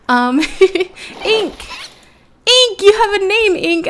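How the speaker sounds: high, loud delighted calls of the name; excited shouting. Real, not acted. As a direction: cheer the new pet's name. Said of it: "I'm so excited".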